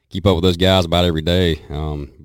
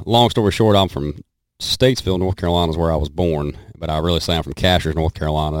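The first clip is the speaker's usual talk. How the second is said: ordinary delivery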